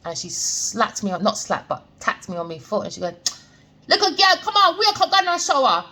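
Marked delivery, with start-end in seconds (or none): 3.9-5.8 high-pitched mock Jamaican woman's voice; bad Jamaican accent. Jamaican woman waking a new patient, badly imitated Jamaican accent. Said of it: "That was a bad Jamaican accent".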